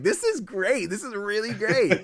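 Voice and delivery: high, giddy reenacted delight; delighted group reaction. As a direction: gush with a laugh creeping in.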